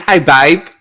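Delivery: a light, mock Essex accent, slow. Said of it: "she talks like Essex".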